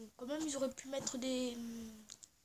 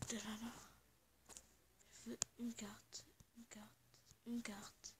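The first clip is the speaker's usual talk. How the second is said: soft murmur; 13 dB quieter than usual. Real, not acted. Murmuring a wish for a map.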